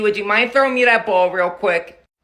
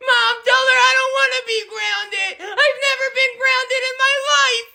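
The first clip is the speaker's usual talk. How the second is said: put-on high, childish pleading to Mom; whiny grown son; 13 semitones higher than usual. Thirty-six-year-old son begging his mother not to be grounded, high childish whine. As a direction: beg like a little kid who's never been punished.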